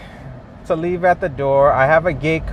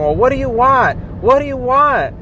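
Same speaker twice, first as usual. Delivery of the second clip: mock yell; 8 semitones higher than usual. Elderly lady yelling at her husband on speakerphone, imitated yell. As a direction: call it out like an old lady on speakerphone. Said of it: "she was yelling into the speakerphone".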